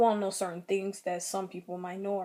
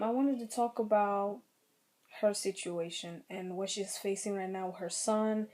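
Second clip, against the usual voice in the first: usual speaking voice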